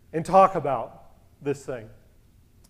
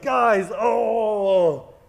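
drawn-out mock panicked cry; freaking out; 3 semitones higher, 4 dB louder than usual. Freaking out to his friends over the text, mock panic. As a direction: wail to the guys in exaggerated dismay. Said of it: "I'm freaking out with my guys".

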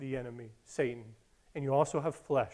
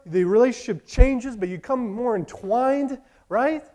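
loud, rising preacher's build; fervent pulpit voice; preaching at full force; 6 semitones higher, 7 dB louder than usual. Real, not acted. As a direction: preach it hard to the room.

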